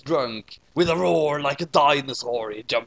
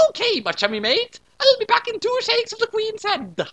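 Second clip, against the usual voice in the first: mock British voice; 3 dB louder than usual. British-style sidekick reply.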